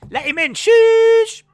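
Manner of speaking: high, loud, drawn-out shush at the crowd. Acted. Streamer shushing a rowdy crowd, loud high shush. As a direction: shush them down hard. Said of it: "I just shooshed everyone".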